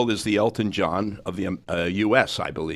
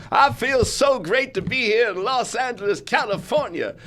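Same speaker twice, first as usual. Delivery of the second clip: high, exuberant showman's talk; big hammed-up stage greeting; 13 semitones higher, 3 dB louder than usual. Mimicking a singer greeting Los Angeles, high exuberant patter. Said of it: "He'll just suddenly start talking".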